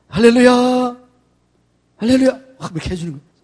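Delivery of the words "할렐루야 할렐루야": hearty greeting. Shown as a demonstration. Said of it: "이렇게 해요".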